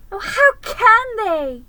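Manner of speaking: very high, loud crying plea; tearful cry. Acted. Lucy weeping as Aslan is mocked, high and crying. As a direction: cry it out through tears. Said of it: "tears streaming down her cheeks".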